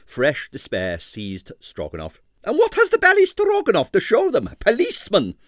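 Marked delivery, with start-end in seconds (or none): 2.5-5.4 high, loud despairing cry; despairing impresario. A narrator's reading. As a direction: wail over the ruined show. Stroganoff in despair.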